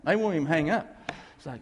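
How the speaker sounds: ordinary delivery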